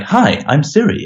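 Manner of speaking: a hint of a chirpy voice assistant; Siri. Voice assistant introducing itself to a user, lightly imitated. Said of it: "speak back to you like".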